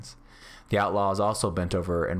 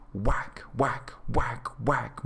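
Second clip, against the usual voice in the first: each "Whack" set apart and stressed; each word emphasized; 4 semitones higher, much slower than usual. A narrator's reading. Beggar's stick strikes outlaws, each "Whack" punched separately. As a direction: land every "Whack" like a separate blow. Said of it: "emphasizing each word".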